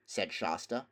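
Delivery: usual speaking voice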